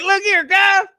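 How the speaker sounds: very high, loud hammed-up hustler call. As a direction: call out to the crowd like a grinning hustler. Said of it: "Fifty was like".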